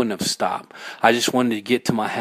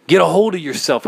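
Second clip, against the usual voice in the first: louder, higher snap at oneself; stern self-rebuke; 6 semitones higher, 5 dB louder than usual. A narrator's reading. Narrator pulling themselves together after breaking down, stern. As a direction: order yourself to calm down, loudly.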